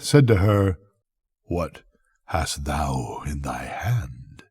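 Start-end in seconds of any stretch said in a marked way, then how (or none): 1.4-4.4 hushed, slow whispered question; eerie whisper. Acted. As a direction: breathe it out like a ghostly presence. Death questions Avarice.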